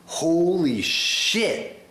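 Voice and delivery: awed. Retold or imitated. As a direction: draw it out, stunned by the sound. Awed reaction to the music.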